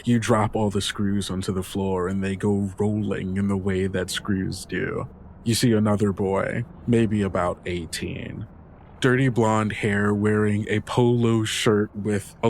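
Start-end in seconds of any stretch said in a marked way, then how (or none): none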